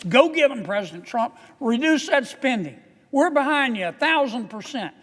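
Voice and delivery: loud, high rallying oration; roman orator. Acted. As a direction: rouse the chamber like a tribune. Rousing cheer for the president.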